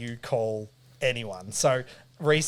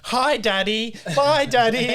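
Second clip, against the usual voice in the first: high sing-song kiddie voices, imitated; chorus of kids; 8 semitones higher, 5 dB louder, slower than usual. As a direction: chirp it like daycare children. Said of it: "every kid's just like".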